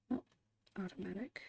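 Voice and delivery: ordinary talk, nothing marked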